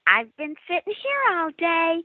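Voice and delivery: put-on eerie little girl voice; little girl ghost. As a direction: voice the ghost child sitting there. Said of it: "it sounded just like a little girl".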